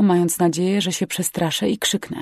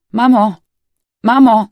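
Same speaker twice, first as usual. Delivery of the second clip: loud, higher cry for mother; frightened calling; 5 semitones higher, 10 dB louder than usual. A narrator's reading. Injured girl calls her mother after crash, frightened. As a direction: call out urgently, much louder.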